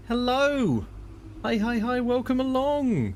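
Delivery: slow bright welcome; high sing-song greeting. Acted. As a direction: greet the chat like a gushing host.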